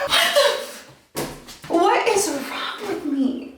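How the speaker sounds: high shocked gasp at herself; high, slow horrified squeal; mock horror. Real, not acted. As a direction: squeal in disbelief.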